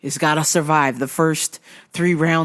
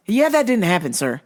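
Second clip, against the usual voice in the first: ordinary talk, nothing marked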